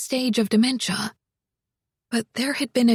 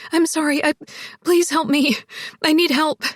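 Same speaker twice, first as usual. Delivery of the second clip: higher, pleading woman in trouble; shaken, urgent plea in a raised voice; desperate, shaky; 5 semitones higher than usual. A narrator's reading. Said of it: "Zoe sounded desperate, shaky".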